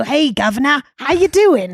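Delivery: high, loud mock Cockney greeting; overdone British accent. Shown as a demonstration. Showing the accent they avoid, overdone British voice. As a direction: ham up a cartoon British hello. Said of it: "I'm not doing the accent like".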